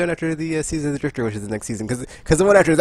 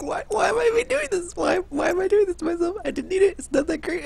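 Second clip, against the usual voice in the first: high-pitched self-pitying whine; high, hammed-up despair; mock despair. Acts out regret over wasted grind.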